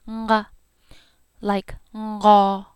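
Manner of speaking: nga starting on an ng sound; nasal nga. Shown as a demonstration. Teacher modelling the nasal nga for students. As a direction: hum ng just before the nga. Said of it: "There's a ng sound right before it".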